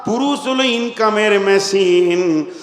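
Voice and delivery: loud sing-song sermon chant; full-force preaching. Acted. (উচ্চস্বরে সুরেলা ওয়াজ)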